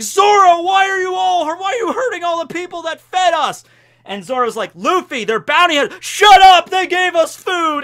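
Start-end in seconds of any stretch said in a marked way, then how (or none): 0.0-3.6 high, loud hammed-up outrage; indignant yelling. Luffy yells at Zoro in quarrel. 4.8-6.0 raised, louder protest; exasperated retort. Retold or imitated. Zoro yells back in acted quarrel. 6.0-7.8 high, very loud interruption; hammed-up furious shout. Luffy cuts Zoro off in quarrel.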